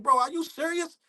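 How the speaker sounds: irritated. Retold or imitated. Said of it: "I was getting so irritated".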